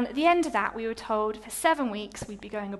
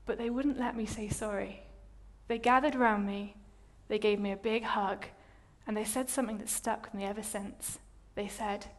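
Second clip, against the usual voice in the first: ordinary talk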